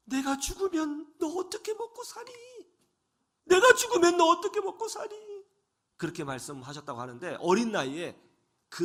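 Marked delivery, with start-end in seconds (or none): 0.1-2.3 mother's lament. Retold or imitated. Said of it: "어머니가 이렇게 말씀을 하셨답니다". 3.5-5.1 high, louder, sobbing through the words; a mother weeping over her crippled son; crying mother. Retold or imitated. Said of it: "어머니가 이렇게 말씀을 하셨답니다".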